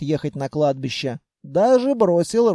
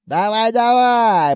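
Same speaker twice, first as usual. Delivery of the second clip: higher, slow drawn-out urging; urging carter; 4 semitones higher, 4 dB louder, slower than usual. A narrator's reading. Carter tips his passenger out, urging him on. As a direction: coax and push with stretched words. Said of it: "приговаривал Торквин".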